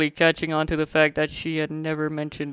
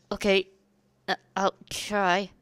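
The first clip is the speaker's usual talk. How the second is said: hesitant Spike; 4 semitones higher than usual. A narrator's reading.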